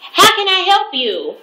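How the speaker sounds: high, chirpy service voice; mock the cheerful drive-thru worker. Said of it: "They said".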